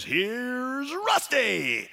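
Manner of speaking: slow, drawn-out stadium announcer; booming announcer intro; announcer call. Retold or imitated. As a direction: stretch the name out like a race-track PA. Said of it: "the announcer comes on and says".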